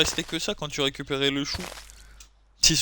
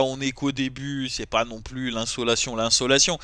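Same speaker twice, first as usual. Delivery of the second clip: normal talking voice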